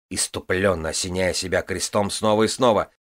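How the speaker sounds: ordinary delivery, nothing marked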